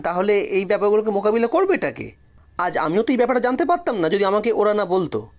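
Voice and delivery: plain reading voice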